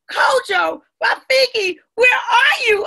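high, loud searching call. A narrator's reading. Amma searching for her hidden friends, calling out.

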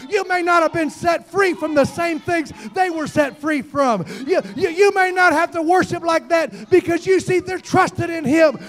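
high, driving pulpit exhortation; full-force preaching. Real, not acted. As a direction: press the point home, loud and high.

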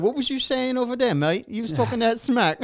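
high-pitched mock British accent; terrible put-on British accent, teasing. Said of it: "There's that British accent".

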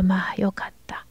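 relieved little whisper. Acted. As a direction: breathe it out in relief. Relieved thought to herself.

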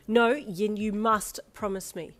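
loud, slowed, emphatic insistence; forceful motherly demand; stern. A narrator's reading. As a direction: press each word firmly. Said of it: "she said sternly".

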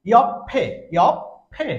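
loud ইয়ফে with doubled p, "yop-phe"; correct pronunciation. Shown as a demonstration. Teacher summing up the added consonant, correct pronunciation. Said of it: "এখানে আরেকটি ফিউব আমরা মনে মনে ধরবো".

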